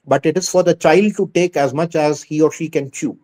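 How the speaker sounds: ordinary delivery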